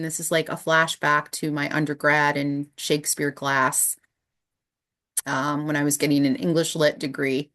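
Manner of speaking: usual speaking voice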